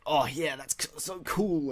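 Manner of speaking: drawn-out "so cool"; slow, savoured admiration; mock impressed reaction. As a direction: imitate someone wowed by a fight scene. Quotes an impressed reaction to fights.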